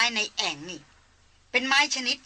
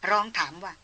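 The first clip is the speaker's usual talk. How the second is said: ordinary delivery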